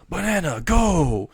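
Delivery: mock heroic shout of a banana attack; battle cry. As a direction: yell it like a fighting-game special move.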